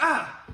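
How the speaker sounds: short high "ah" of a sudden sting; mock yelp of surprise. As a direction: yelp as if you just got hurt. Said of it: "from the surprise of the shock".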